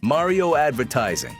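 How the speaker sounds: normal talking voice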